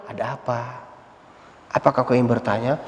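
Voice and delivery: gentle reply. Retold or imitated. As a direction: answer kindly and softly. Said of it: "jawabnya dengan lembut".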